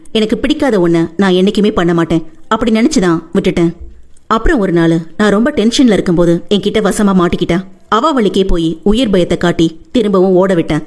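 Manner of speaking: ordinary talk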